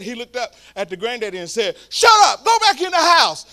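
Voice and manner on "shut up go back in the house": angry shout, much higher and louder; upset yell. Retold or imitated. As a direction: bark it at the old man. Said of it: "he got so upset".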